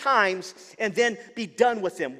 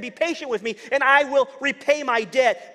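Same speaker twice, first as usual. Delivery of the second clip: begging; 6 semitones higher, 7 dB louder than usual. Acted. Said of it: "he begged him".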